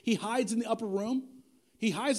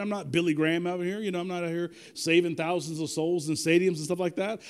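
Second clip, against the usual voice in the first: normal talking voice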